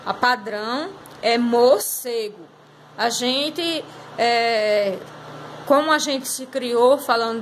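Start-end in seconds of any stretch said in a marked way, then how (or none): 1.4-2.5 morcego slowly, with a full "mor"; careful, drawn-out "mor-cego"; formal standard pronunciation. Shown as a demonstration. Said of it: "a linguagem formal, a padrão".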